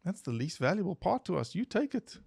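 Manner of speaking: high put-on villager voice; locals' voice. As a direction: play it high and carefree. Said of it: "And they're like".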